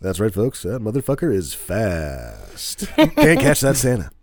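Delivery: pilot voice. Retold or imitated. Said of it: "the pilot was like".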